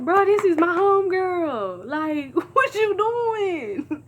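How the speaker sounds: high, loud, laughing through the words; squealing with laughter in disbelief. Retold or imitated. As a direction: crack up at the absurdity.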